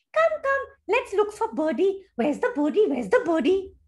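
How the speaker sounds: high, urging little squirrel; eager squirrel. A narrator's reading. Squirrel urging friends to seek Birdie, high and eager. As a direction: hurry the others along in a high voice. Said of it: "the squirrel came down and said".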